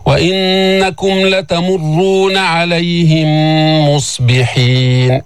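slow chanted verse; Quran recitation. Shown as a demonstration. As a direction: recite evenly with held nasal. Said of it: "teacher recites verse".

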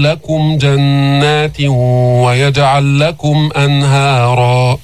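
slow, measured tajweed recitation; steady melodic Quranic chant. Shown as a demonstration. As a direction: chant the verse with long, held vowels.

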